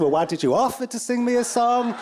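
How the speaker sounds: high, exasperated why; mock frustration; exasperated question. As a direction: push back, exasperated and incredulous. Frustrated interviewer.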